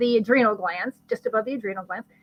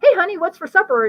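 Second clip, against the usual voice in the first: high, loud, oblivious dad voice; breezy dad; 8 semitones higher, 7 dB louder, faster than usual. Acted. Dad walks in on frazzled mom, breezy call. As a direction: call it out quickly and cheerily.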